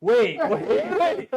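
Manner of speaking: loud, high double take; sudden realization. Retold or imitated. Actor learning he got Ultraman, loud disbelief. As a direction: blurt it out in mock shock.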